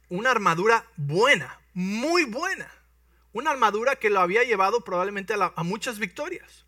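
high, loud praise of the armor; preacher hyping the king's armor; excited emphasis. Real, not acted. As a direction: build up its greatness.

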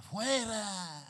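put-on old woman shouting. Preacher imitating old woman rebuking the shadow, mock shout. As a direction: bark out the rebuke like the old prophetess. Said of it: "Escúchala como grita".

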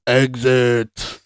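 mock shout. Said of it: "he shouts".

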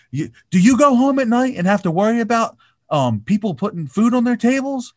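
high, loud mock scolding; imitated rant. Tom Cruise mimicked berating two crew members. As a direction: channel a boss tearing into staff. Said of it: "He's like".

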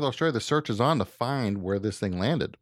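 ordinary talk, nothing marked

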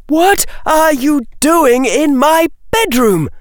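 very high-pitched indignant shriek. A narrator's reading. Girl to intruder in her bedroom, shrieking. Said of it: "shrieked Daphne".

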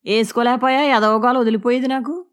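acted exasperated outburst; irritated retort. A narrator's reading. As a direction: snap back at the wife, fed up. Husband's callous retort in quarrel.

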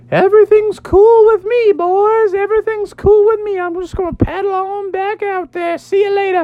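very high falsetto surfer hero; jolly high-pitched bravado; Daniel Tiger. Acted. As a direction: whoop it up like a cartoon surfer dude.

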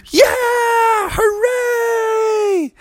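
very high shouted hooray; band cheering. Acted. Bandmates run on stage cheering, high shout. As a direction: whoop together like winners.

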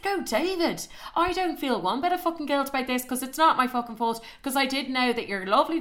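high-pitched scornful retort; indignant rebuke. Retold or imitated. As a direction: refuse all blame, exasperated. Angrily rejects his consolation.

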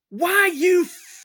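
very high, loud, slurred snarl; furious cut-off curse. Acted. Drunk Hrungnir at the sheriff, screaming and high. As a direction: lunge at him in drunken fury.

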